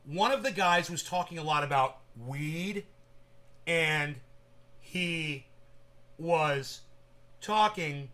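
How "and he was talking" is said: imitating slow halting speech. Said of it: "like this".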